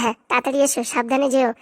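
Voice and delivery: normal talking voice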